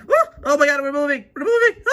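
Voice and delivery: high, loud alarm; panicked. Acted. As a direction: cry out as the ride lurches off. Ride starts, characters panic.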